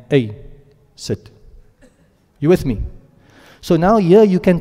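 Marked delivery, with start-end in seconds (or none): 0.1-1.3 abrupt order, sit much softer; a short order, broken after Hey; curt command. Shown as a demonstration. Teacher repeating the blunt phrase for students, curtly.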